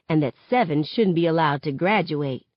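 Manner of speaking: ordinary delivery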